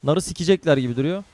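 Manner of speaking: ordinary talk, nothing marked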